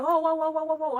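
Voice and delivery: high, loud panicked whoas; alarmed whoa. Retold or imitated. Boss speeding in a Ferrari.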